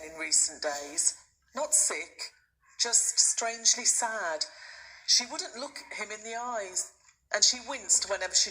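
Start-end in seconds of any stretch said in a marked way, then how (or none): none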